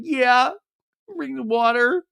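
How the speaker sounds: high-pitched mock whimper. Crying wife accepting water from husband, mock whimper. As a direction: imitate a tearful wife, drawn high.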